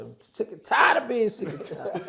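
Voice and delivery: playful imitation of a preacher-style catchphrase; mock quote. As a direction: ham up the famous saying, laughing through it. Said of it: "I would listen to [name]".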